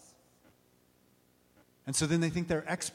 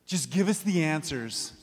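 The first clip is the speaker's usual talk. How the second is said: a touch of pleading; mild plea for answers; Thomas crying out. Retold or imitated. Thomas begs Jesus for answers, mildly pleading. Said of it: "Thomas finally cries out".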